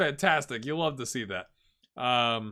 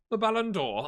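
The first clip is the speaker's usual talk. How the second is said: Ballon d'Or in an oddly shifted accent, pitched up; accent gone strange, higher pitch; odd slipped accent; 7 semitones higher than usual. Real, not acted. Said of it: "I don't know what happened to my accent".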